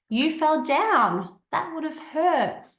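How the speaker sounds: high, slow, caring acknowledgement; empathetic. Shown as a demonstration. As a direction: acknowledge the child's pain. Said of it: "communication skill to have".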